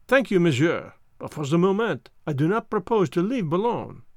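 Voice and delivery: put-on French-accented detective declining; Poirot, politely firm. Poirot turns down the waiting car, politely firm, French-accented.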